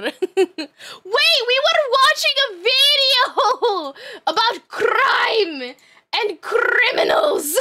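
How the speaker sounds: high, slow, hammed-up trilled R's; theatrical wail with rolling R's; rolled R's. Shown as a demonstration. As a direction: draw it out and roll the R's, laughing. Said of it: "I'm rolling the R's for you".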